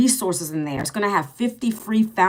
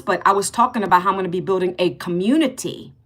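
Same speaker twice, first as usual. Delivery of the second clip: normal talking voice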